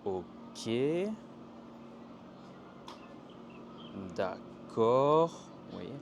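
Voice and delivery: drawn-out, unsure agreement rising at the end; doubtful, lingering assent; hesitant. Shown as a demonstration. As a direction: agree reluctantly, stretching the syllables. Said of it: "L'hésitation".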